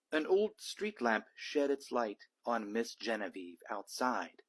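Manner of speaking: ordinary delivery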